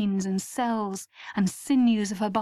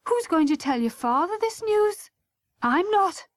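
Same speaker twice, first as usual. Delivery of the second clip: mildly indignant, higher voice; upset mother; 9 semitones higher than usual. A narrator's reading. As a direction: refuse with a touch of alarm. Mother refuses to tell the father.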